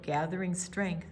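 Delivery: usual speaking voice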